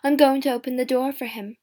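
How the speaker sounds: loud, eager announcement; excited cry. A narrator's reading. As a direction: call it out with excitement. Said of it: "she cried".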